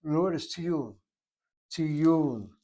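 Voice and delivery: tiyul with the second syllable stressed and drawn out; emphasized properly. Shown as a demonstration. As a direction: lean firmly on the end of tiyul. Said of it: "If you want to emphasize it properly".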